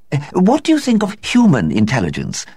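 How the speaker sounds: high hesitant society woman; lame question. A narrator's reading. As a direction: ask lamely, unsure. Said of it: "asked Mavis Pellington lamely".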